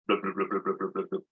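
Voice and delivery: quiet low blub blub of a stream; soft bubbling blubs. Shown as a demonstration. Teacher gives kids the stream sound, soft low blubs. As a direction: bubble it softly like water. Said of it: "babbling stream".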